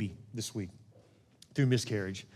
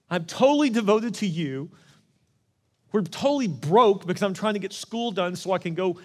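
raised, higher voice crying out to God in anger; hurt, indignant outburst, pushed up high and loud; angry plea; 6 semitones higher, 6 dB louder than usual. Retold or imitated. Grieving young husband rails at God over the lost baby, angrily. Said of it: "being so mad".

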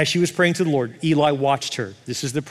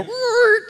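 high squealed record scratch; turntable scratch; 3 dB louder than usual. Acted. Preacher mimics turntables in heaven, high scratching screech. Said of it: "turntables in heaven go".

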